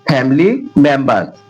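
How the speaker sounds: usual speaking voice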